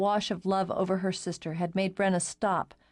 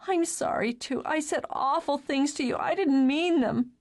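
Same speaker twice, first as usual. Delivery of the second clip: sobbing apology; 8 semitones higher than usual. Acted. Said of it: "sobbed it out".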